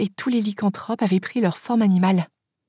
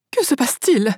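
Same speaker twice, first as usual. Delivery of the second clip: higher-pitched, alarmed question; mild cry; 5 semitones higher than usual. A narrator's reading. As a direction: ask it as a restrained cry. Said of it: "cria Baverly". (cri modéré, plus aigu)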